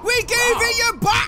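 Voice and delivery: high-pitched, indignant protest; indignant shout. Real, not acted. Player denies robbing game character, indignant shout. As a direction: shout back at a false accusation.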